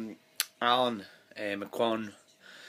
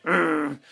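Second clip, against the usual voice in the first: loud mock groan of disgust; petulant urgh; 4 semitones higher, 6 dB louder than usual. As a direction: grunt in bored annoyance.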